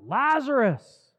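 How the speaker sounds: high, loud, drawn-out call. Retold or imitated. Jesus summons dead Lazarus from the tomb, calling out. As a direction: call the name out slowly and powerfully. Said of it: "he calls out".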